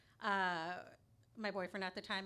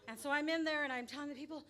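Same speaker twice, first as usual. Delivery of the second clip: ordinary talk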